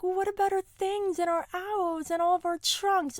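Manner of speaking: high-pitched worried schoolgirl question; Ravenclaw girl. Acted. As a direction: fret in a high student voice. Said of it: "a Ravenclaw girl's like".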